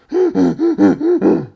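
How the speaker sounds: high, loud hee-hawing laugh; donkey laugh. Retold or imitated. Storyteller imitates a classmate's goofy laugh, donkey-like. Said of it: "laugh like a fucking donkey".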